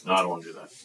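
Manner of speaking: low, soft dismissive answer; bored refusal. Acted. Teen in church youth group refusing, bored. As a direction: shoot it down with no energy.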